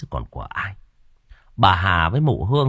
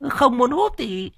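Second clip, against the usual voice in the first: much higher, smug preening voice; puffed-up boasting; 14 semitones higher than usual. Acted. Cúc boasts to her husband about the land, smug high voice. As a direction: crow about your luck. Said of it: "Mụ Cúc phỏng mũi".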